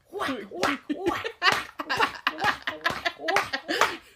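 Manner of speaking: high, loud, laughing whack sounds; mock whacks. Mom imitating her daughter whacking the spider, laughing. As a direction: chant the whacks while laughing.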